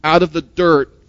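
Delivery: ordinary talk, nothing marked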